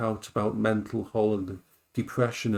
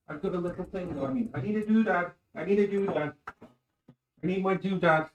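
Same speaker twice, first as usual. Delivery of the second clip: silly high voice; 6 semitones higher than usual. Pretend. Playful search for strainer.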